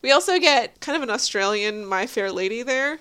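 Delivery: usual speaking voice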